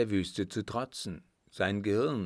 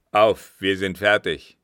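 louder, calling out across the camp; 10 dB louder than usual. A narrator's reading. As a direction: summon him up with a call. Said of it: "ein Ruf Richemonts". (lauter Ruf zum Aufbruch)

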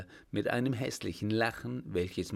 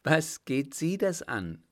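higher, indignant retort; interrupting; 6 semitones higher, 4 dB louder than usual. A narrator's reading. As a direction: cut him off indignantly. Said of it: "unterbrach sie ihn". (empört unterbrechend)

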